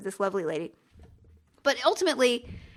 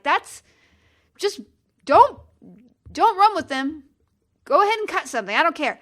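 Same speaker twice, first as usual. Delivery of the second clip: louder, higher retold scolding; exasperated parent; 4 semitones higher, 6 dB louder than usual. Mother to toddler running with scissors, exasperated. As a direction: give up loudly on scolding the toddler.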